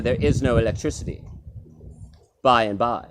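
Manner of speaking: ordinary talk, nothing marked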